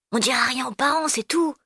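usual speaking voice

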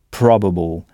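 probable said the English way, stress on PRO; English pronunciation. Shown as a demonstration. Teacher modelling English probable. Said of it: "On frappe en quelque sorte sur cette syllabe".

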